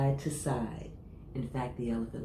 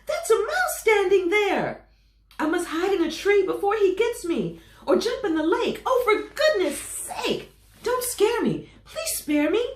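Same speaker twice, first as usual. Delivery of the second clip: panicked pleading; 9 dB louder than usual. Acted. Elephant begging the mouse to spare him, panicked. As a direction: plead for mercy, scared silly.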